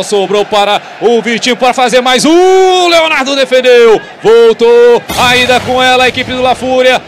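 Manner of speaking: excited play-by-play. Acted.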